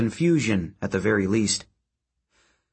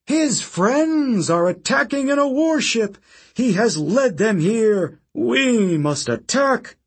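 high, loud, slow raging demand; furious call to arms; 10 semitones higher, 3 dB louder, slower than usual. Acted. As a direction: rouse the crowd to war. Octavian rallying the Senate to attack.

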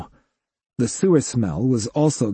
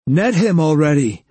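louder, urgent call; urgent shout; 5 semitones higher, 5 dB louder than usual. A narrator's reading. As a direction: yell it across the tunnel.

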